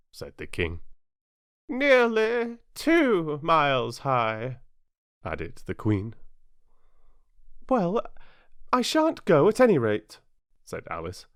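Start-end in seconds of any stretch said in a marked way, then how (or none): 1.7-4.6 slow, high and loud queen; queen adding to the king's claim. Acted. Queen adds. 7.7-10.2 high, loud defiance; Alice refusing to leave; defiant Alice. Acted. Alice defies king.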